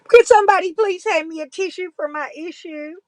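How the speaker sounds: loud, high fake-sobbing whine; mock crying plea. Vlogger begging viewers for a tissue, loud mock wail. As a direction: beg for a tissue like a sulky child. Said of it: "I'm cry bullying".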